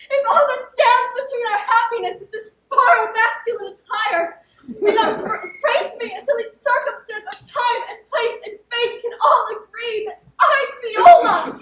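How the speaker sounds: high, loud, laughing and tearful outburst; overjoyed reveal. Acted. Sister revealing herself to her long-lost brother, overjoyed outburst. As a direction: build to a joyful shout on the name.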